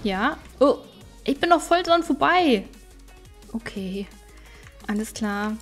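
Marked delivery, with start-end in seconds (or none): none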